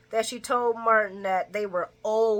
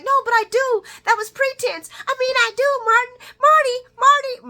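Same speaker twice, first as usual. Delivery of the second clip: very high, loud desperate begging; cling to him as he leaves; frantic pleading; 10 dB louder than usual. Retold or imitated. Lucy begging Martin not to walk out, very high and loud.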